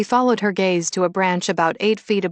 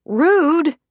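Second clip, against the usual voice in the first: loud, high yell across the cafe; much higher, loud cupped-hand shout; yelled through cupped hands; 10 semitones higher, 7 dB louder than usual. Acted. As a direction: call it out to shame the eavesdroppers. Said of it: "she cupped her hands over her mouth and yelled".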